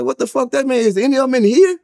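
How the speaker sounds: high, outraged demand; going off. Retold or imitated. Arguing with the guard, high outraged demand. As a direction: fire the question back in outrage. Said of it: "I went off".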